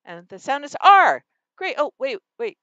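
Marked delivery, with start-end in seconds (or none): 0.0-1.2 R said as one loud phoneme; isolated sound. Shown as a demonstration. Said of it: "one phoneme".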